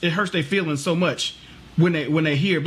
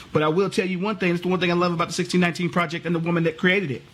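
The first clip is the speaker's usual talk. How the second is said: ordinary talk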